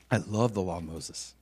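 low, soft restatement; understated, sincere avowal; hushed confession. Real, not acted. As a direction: repeat it quietly, letting it sit. Repeats confession after long pause.

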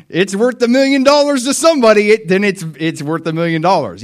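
high, loud burst of conceding; loud, high-pitched reckoning; excited concession. Acted. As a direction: blurt it out high and emphatic. Imagined reply conceding a high price.

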